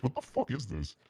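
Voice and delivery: ordinary talk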